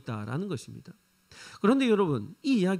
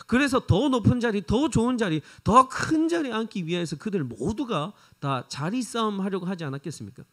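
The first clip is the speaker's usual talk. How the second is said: ordinary talk, nothing marked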